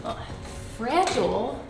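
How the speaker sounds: usual speaking voice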